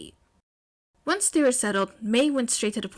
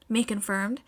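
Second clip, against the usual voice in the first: plain reading voice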